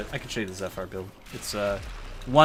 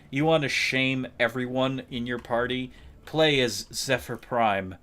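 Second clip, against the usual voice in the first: ordinary delivery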